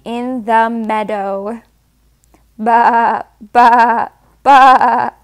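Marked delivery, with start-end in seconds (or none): 2.6-5.1 louder mock sheep baa, each one stronger; sheep bleating. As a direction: bleat like a playful sheep for a toddler. Sheep sounds in farm book.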